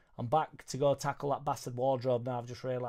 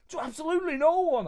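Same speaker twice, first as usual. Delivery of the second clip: much higher and louder mock Irish fighter brag; Conor McGregor voice; 10 dB louder than usual. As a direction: ham up a cocky Irish fighter, yelling it high. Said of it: "I can't do his accent".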